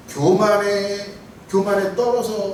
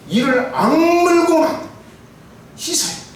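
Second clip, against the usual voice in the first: gritted teeth; 9 semitones higher, 4 dB louder, slower than usual. Acted. Urging with clenched teeth.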